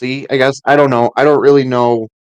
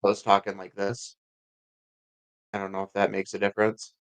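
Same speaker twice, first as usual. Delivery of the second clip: much softer, away from the mic; talking off mic; 2 semitones lower, 12 dB quieter than usual. Shown as a demonstration. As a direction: demonstrate talking off to the side of the mic. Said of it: "instead of talking into the mic like I am now".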